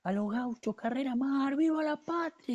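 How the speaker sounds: officer shouting the men forward, slowing on the last cry; battle cry. Retold or imitated. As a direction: cry out to lead the charge uphill. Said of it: "que nos grita".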